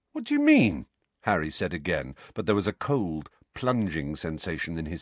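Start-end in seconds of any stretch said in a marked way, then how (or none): 0.1-0.9 sharp high alarmed question. A narrator's reading. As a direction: demand it, shaken. Harry alarmed.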